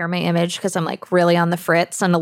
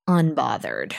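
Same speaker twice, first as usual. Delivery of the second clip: ordinary talk, nothing marked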